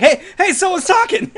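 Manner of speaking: high, loud, excited cry; excited calling out. Retold or imitated. Player retelling his discovery of in-game voice, excited and high. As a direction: call out in amazed discovery.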